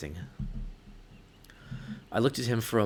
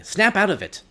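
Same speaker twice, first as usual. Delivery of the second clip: urgent self-command; 10 semitones higher, 9 dB louder than usual. Acted. Urging herself to snap out.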